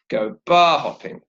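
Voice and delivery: "bar" stressed and held, "hopping" falling. Shown as a demonstration. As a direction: hit "bar", ease off on "hopping". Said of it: "it's actually the same pattern".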